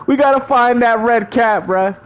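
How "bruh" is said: usual speaking voice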